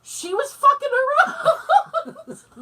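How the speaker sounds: high, loud, drawn-out protest through laughter; imitated indignant dad. Dad excusing his lack of sympathy, laughing mock protest. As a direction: protest shrilly while cracking up. Acted dad's defence, laughing.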